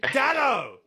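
high-pitched, drawn-out mock holler; retold shout. Host retelling his red-carpet blurt, shouted out. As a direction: call out the name like an overexcited fan. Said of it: "so I just shouted out".

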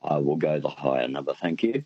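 ordinary talk, nothing marked

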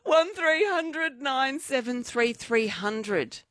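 wailing appeal for callers, slowly; mock desperate plea. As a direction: plead for someone to ring, stretching each number.

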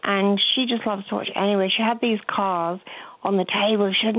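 ordinary delivery

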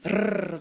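a short trilled brrr on the gum ridge; rolled R trill. Shown as a demonstration. Dialect coach demos a rolled R for listeners. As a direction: show the trill, tongue tip buzzing. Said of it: "tapping the tip of your tongue in a trill".

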